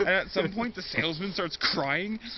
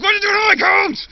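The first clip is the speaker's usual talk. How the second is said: very high, loud yell; dad yells at the driver on his couch; angry yell; 15 dB louder than usual. Retold or imitated. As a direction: scream the accusation.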